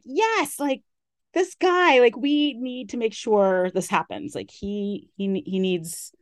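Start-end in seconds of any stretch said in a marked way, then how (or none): 0.0-4.3 high, eager burst of enthusiasm; gushing yes, we need this; excited inner cheer. Retold or imitated. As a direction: cheer it on like a fan. Excited quote of her own thought.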